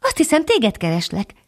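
a small titmouse calling from the bean stalk; tiny high bird voice; high little bird voice. A narrator's reading. Bird character speaks.

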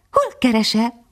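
put-on young dog introducing himself to the bird; eager young dog. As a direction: speak like a young, awed puppy. Young dog voiced in story.

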